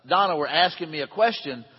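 usual speaking voice

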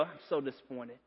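low, hushed imitation of a let-down father; disappointed dad. Dad to his waiting boys at discipline time, hushed disappointment. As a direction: drop the voice far down, imitating dad's disappointment. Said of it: "to look at you and go".

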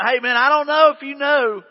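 half-laughing protest, like a buddy; casual Ananias. Acted.

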